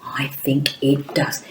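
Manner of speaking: quiet whispered reassurance. Shown as a demonstration. Teacher whispering to pupils that it matches. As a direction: breathe it softly.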